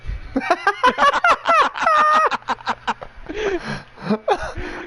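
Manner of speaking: loud high burst of laughter; big laugh. Real, not acted. Telling a joke to friends, loud real laughter. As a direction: crack up at your own punchline.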